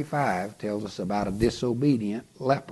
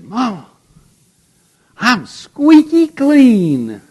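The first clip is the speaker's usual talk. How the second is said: put-on excited little boy; 14 semitones higher, 14 dB louder, slower than usual. Boy calls to his mother.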